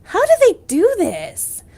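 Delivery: loud astonished how; amazed disbelief. Retold or imitated. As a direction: shout it in amazement. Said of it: "and I'm like".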